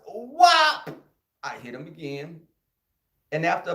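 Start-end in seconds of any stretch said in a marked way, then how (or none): 0.1-0.9 loud, high "Whop!" hit sound. Retold or imitated. Kid telling the crowd how he hit the bully, whop sound effect. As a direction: smack the word out like a blow.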